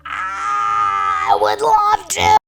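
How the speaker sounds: drawn-out, high, loud shout of delight; joyful shout. Acted. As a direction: blurt it out, overjoyed. Dog bursts out with joy.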